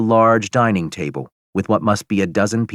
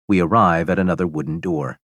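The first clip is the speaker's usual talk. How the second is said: ordinary delivery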